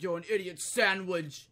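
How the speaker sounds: loud mock chef scolding; Gordon Ramsay insult. Chef insulting a cook, mock angry. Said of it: "and he's like".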